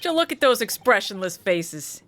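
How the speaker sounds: broad New York mobster talk; New York accent. Acted. Old mobster sizing up foreigners, New York accent. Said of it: "slip into a New York accent".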